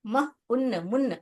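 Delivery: मून read as मुन्न with short u; wrong short u. Shown as a demonstration. Teacher showing pupils the wrong short vowel. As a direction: clip मून to मुन्न on purpose. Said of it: "अगर मैं इसको ऐसे पढ़ूंगी".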